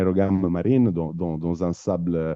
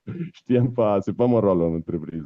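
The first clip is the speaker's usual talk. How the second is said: normal talking voice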